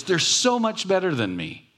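high, whining mock envy; father mock-whining about his talented kids; whiny envy. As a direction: whine it way up like a jealous parent. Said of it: "envious whine about his kids".

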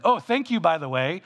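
high, loud, hurried apology to God; mock sheepish thanks. Preacher acting his late morning prayer, high and loud. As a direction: blurt it out like an afterthought thank you.

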